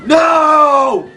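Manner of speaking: long, loud cry of dismay; anguished shout. Real, not acted. Player failing the level, shouting in anguish. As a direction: wail it out loudly.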